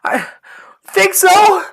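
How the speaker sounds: loud, high yelled reply; yelling back. Acted. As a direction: yell it back to someone far off. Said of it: "You can hear [name] yell back".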